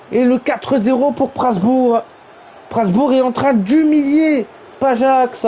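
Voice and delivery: high, stretched-out excited goal call; long goal yell. Real, not acted. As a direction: roar the goal and let it ring. Goal scored in commentary.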